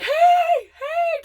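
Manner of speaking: high, loud shouted hey; yelling over a lawnmower; calling out. Retold or imitated. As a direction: shout to get his attention, pitched high. Calling to landlord.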